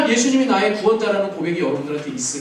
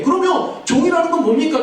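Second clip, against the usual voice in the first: normal talking voice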